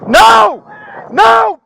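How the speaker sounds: loud urgent no; shouting. Real, not acted. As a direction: yell it at him to stop. Officer yelling at armed man.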